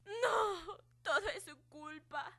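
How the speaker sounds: put-on crying, grief-choked girl; sobbing. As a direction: sob it out brokenly.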